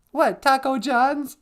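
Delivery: high, slow put-on character question; sidekick voice. Sidekick querying the Minnesota thing, high character voice.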